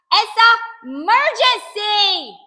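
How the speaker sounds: high, loud, stretched-out urgent cry; shouting. A narrator's reading. Child needing the toilet at stuck door, shouting urgently. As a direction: wail it out at full volume. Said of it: "I shouted".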